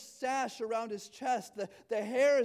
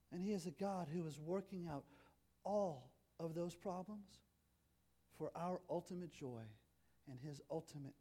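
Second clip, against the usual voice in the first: quiet tender sermon ending; soft reflective close; 4 semitones lower, 10 dB quieter than usual. Acted. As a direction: gently comfort a hurting congregation. Closing reflective appeal.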